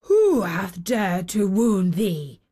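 slow, indignant giant voice; angry giant. A narrator's reading. Giant seeing the child's wounds, slow anger. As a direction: draw out the outrage as a storybook giant. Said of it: "his face grew red with anger".

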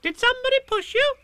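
very high, loud, squeaky question; high-pitched eager squeak; excited Roo. A narrator's reading. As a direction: pipe up like a thrilled little one. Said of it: "said Roo excitedly".

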